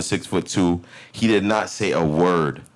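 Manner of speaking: normal talking voice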